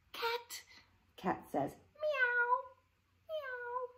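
high soft mewing meow; cat meow. Shown as a demonstration. Teacher meowing like a cat for the kids. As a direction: mew it like a little cat. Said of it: "Say cat".

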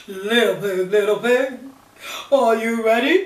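loud wolf calling; big bad wolf shouting at the door. Wolf calls.

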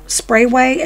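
ordinary talk

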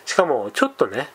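ordinary delivery

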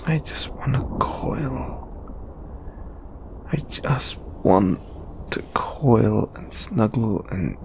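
slow, soft near-whisper; wistful longing. Acted. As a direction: sigh out a private wish. Secretly longing for cuddles.

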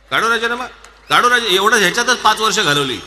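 high, loud mocking jeer; mocking impression. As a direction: jeer at their empty threats. Mocking ministers' resignation threats.

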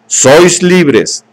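lifted, ringing proclamation; proclaiming. A narrator's reading. Savage holding up the empty box to the crowd, proclaiming. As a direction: declare it to the crowd like a prophet.

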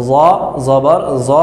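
"জ জবর জ" with an emphatic buzzing z; each "জ" a thick z with rounded o; heavy Arabic Za. Shown as a demonstration. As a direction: spell "জ জবর জ" as in Arabic recitation. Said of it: "আরবিতে উচ্চারণ করি".

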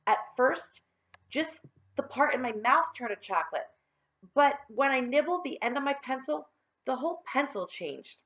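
put-on high-pitched boy recounting his trouble; aggrieved schoolboy.

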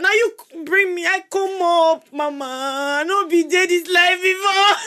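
mock homage. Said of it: "this is absolutely not".

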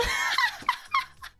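high squealing burst of laughter; shrieking laugh. Real, not acted. Squealing after "muscular women, help.

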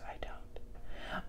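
breathy whispered admission; whispered aside. Real, not acted. To her viewers, a sheepish whispered aside. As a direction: drop to a whisper for the confession. Admits she doesn't fix cars.

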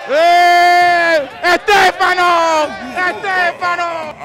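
loud, slow, drawn-out call to a friend across the crowd. Real, not acted. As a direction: stretch the name out and yell it. Yelling for a friend.

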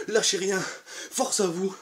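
ordinary talk, nothing marked

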